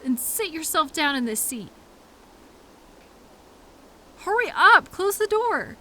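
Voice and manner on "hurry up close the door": loud, high impatient yell. Acted. Driver yelling at coworker about the open door. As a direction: snap at them to shut the door. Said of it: "I'm sorry I yelled".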